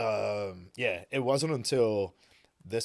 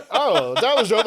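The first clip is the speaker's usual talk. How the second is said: much higher, loud, amazed; impressed; 13 semitones higher, 15 dB louder, slower than usual. Retold or imitated. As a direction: gush like an excited therapist. Therapist impressed by leg raise.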